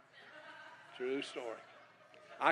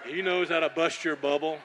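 mock prophetic shout; 3 semitones higher, 7 dB louder than usual. Bob's warning, knife in face.

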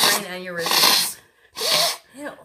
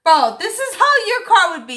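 mocking echo; 10 semitones higher, 6 dB louder than usual. Mocking his line back louder.

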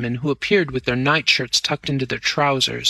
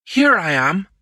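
raised, breathless answer; breathless call; 5 semitones higher, 4 dB louder than usual. A narrator's reading. Crowley calling back from the chase, breathless. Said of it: "called a breathless voice".

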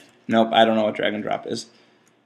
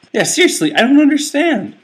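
exasperated; 13 semitones higher, 5 dB louder than usual. Real, not acted. Exasperated at the level.

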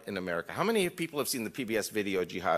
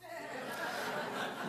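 quiet airy chuckle at his own punchline; soft breathy laugh; 11 dB quieter than usual. Real, not acted. Laughing at the German reply.

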